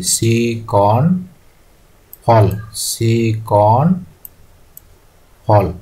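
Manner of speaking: very slow, broken word by word; slow dictation. Real, not acted. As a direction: stretch the syllables as if writing along. Spelling out while writing it down.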